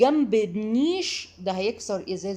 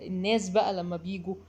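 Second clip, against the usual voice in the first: normal talking voice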